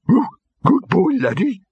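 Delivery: high, loud talking-dog bark; excited-sounding doggy woof in words. A narrator's reading. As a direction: yelp the words, pitched right up. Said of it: "The barking was louder this time".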